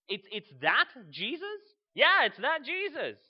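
incredulous, rising questions building to an excited answer; astonished disbelief. Acted. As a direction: act out someone who can hardly believe it. Acting the blind man's disbelief.

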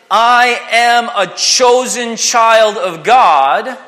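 slow proclamation. Shown as a demonstration. Proclaiming the truth of the Lord.

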